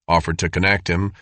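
plain reading voice

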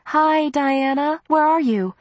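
high, slow, drawn-out warm greeting; warm and comforting. A narrator's reading. Em picks up the extension to comfort Diana, warm. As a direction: greet her sweetly and soothingly, pitched high. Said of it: "her voice warm and comforting".

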